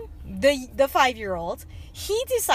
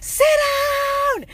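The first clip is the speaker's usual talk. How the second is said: drawn-out mock scream; acting out a parent's yell at a child; retold screaming; 13 semitones higher, 4 dB louder than usual. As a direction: stretch each word into an exasperated yell. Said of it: "literally screaming and swearing".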